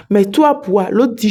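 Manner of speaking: ordinary delivery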